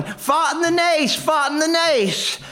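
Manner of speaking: high, strained mock cry for mercy; a hammed-up tough guy begging; panicked yelling. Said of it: "He's yelling".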